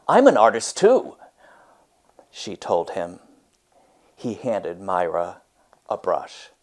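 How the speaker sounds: lively read-aloud storytelling voice; story narration. A narrator's reading. Teacher reads a picture book to her class, expressive read-aloud.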